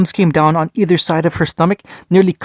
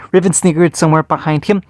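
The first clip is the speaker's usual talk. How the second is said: plain reading voice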